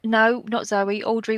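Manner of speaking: ordinary talk, nothing marked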